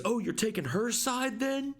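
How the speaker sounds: high put-on girl voice, accusing; hammed-up jilted girlfriend; mock jealous girl. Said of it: "And she goes".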